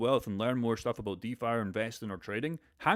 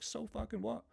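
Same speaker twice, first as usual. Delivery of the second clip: high squeaky so-what, much higher and softer; scoffing dismissal; 12 semitones higher, 7 dB quieter than usual. Real, not acted. Streamer mocking the fear of a plane crash, high and scoffing. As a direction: toss it off as ridiculous.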